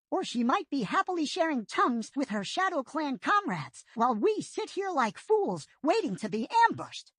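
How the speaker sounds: a quick-thinking apprentice making a plan; Firepaw's voice. A narrator's reading.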